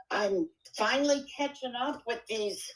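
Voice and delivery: ordinary talk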